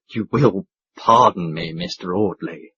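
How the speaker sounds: short of breath, as if wheezing; asthmatic breathlessness. A narrator's reading. Proprietor to Mister Audley, breathless and worried. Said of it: "with asthmatic breathlessness".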